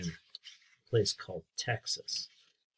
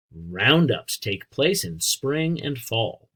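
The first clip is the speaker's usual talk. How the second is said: clear, louder storybook reading; reading to children; 5 dB louder, slower than usual. A narrator's reading. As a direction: read it out for a class of kids. Teacher reads book to children.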